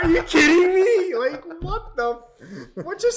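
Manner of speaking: high, loud, laughing protest; stretched-out shriek of outrage; mock disbelief. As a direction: wail it in hammed-up shock. Reenacting shock at being fired.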